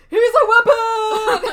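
high, loud, slow laughing cry; mock horrified shout. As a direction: wail it out in fake panic. Crowd acted shouting a reveal.

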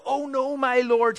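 high, drawn-out cry of dismay; read-aloud wail of dismay. A narrator's reading. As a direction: cry out in alarm, pitched well up. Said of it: "he cried out".

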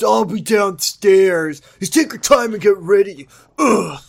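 mock him as a sulky brat; whiny mock Ricky. Mocking Ricky's line to Amy, whiny and high. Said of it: "Ricky to stop being dicky".

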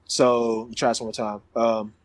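ordinary delivery

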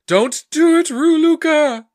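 high, loud dramatic shout; crying to the heavens. Acted. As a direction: call out desperately across the sky. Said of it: "Then he cries to the heavens".

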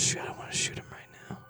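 breathy unvoiced reply; whisper. [name] whispering his intent to his friend, unvoiced. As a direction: keep whispering back.

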